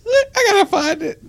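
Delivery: high, laugh-cracked voice; giggling plea; laughing whine. Real, not acted. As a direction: squeak it out through laughter. Laughing while searching his phone.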